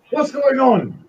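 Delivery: high, loud alarmed shout; guards freaking out. Acted. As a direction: shout it in sudden panic. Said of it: "everybody go freaks out".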